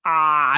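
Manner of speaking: short loud fake cry; mock yell. Crewmates yelling it out together, loud mock cry. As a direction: belt out a hammed-up yell.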